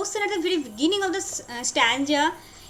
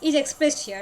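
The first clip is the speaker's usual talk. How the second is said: ordinary delivery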